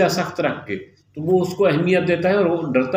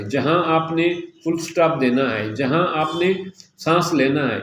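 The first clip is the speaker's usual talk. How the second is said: normal talking voice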